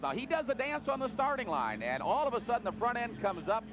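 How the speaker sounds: rising excited play-by-play; tractor pull announcer. Real, not acted. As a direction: call the pull live, building up.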